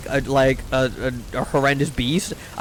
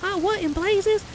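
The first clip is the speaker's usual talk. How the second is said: falsetto Southern belle impression; exaggerated high Southern belle, slowing on the exclamations; high-pitched girl's voice; 4 dB quieter than usual. Shown as a demonstration. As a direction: put on a squeaky-high lady voice. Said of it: "I can't do a girl's voice that's not high-pitched".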